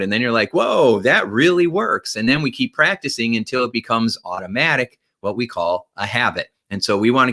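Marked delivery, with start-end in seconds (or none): 0.5-2.2 high, drawn-out acted "whoa"; amazed discovery. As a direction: play pleasantly astonished, slowly. Acts out discovering that it works.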